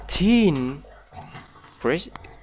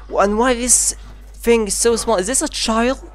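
repeated stunned question climbing higher and louder; growing horror at each look; horrified disbelief; 10 semitones higher, 4 dB louder than usual. Real, not acted. Discovers horrific creatures, rising disbelief.

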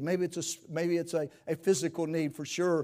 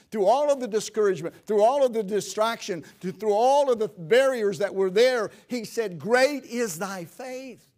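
loud, high, driving sermon voice; rising revival preacher in full cry; preaching at full force; 5 semitones higher, 6 dB louder than usual. Acted. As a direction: build the fervour, hammering each phrase.

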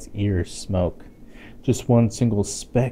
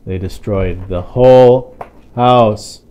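dramatic dismay; 10 dB louder, slower than usual. Acted. House wrecked in story.

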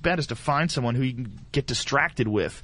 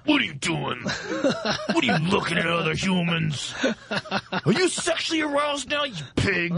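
mock angry girlfriend; 6 semitones higher than usual.

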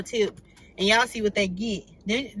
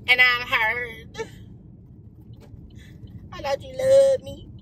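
mock hurt; 3 dB louder, slower than usual. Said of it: "you lied to me".

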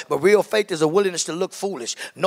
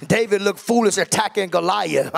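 usual speaking voice

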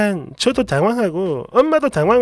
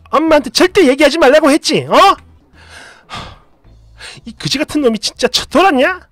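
louder, higher screaming at the husband; furious tirade; 4 semitones higher, 4 dB louder than usual. Retold or imitated. Wife yelling at husband for hurting her mother, furious. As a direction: tear into him with rage. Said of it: "막 미친 듯이 퍼붓는데".

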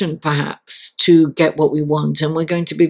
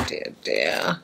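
whispered dismay; 10 dB quieter than usual. Real, not acted. Reacting to a card.

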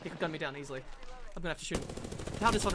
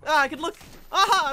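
loud, raised, exultant bursts; excited battle shouts; 5 semitones higher, 8 dB louder than usual. Real, not acted. Player's ultimate returns mid-fight, excited shouting.

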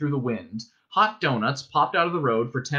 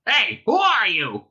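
loud, high, stretched quacking demand; 9 semitones higher, 5 dB louder than usual. A narrator's reading. Ford as penguin challenging the voice, quacking. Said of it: "he quacked".

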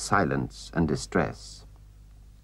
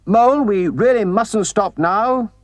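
high, raised voice thrown over the shoulder; loud, hurried refusal; calling back; 11 semitones higher, 5 dB louder than usual. A narrator's reading. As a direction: call firmly to someone lagging behind. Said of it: "he called back".

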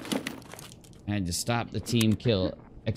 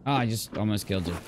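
ordinary talk

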